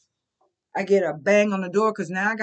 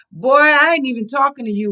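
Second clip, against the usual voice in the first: louder, heated snap at him; angry retort; 2 semitones higher, 8 dB louder than usual. Retold or imitated. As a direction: put him in his place. Arguing with the man.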